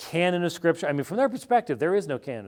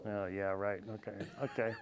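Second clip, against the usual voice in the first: much softer, unimpressed oh yeah right; sceptical brush-off; 5 semitones lower, 14 dB quieter than usual. Retold or imitated. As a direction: shrug it off like you don't buy a word of it. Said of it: "and you're gonna go".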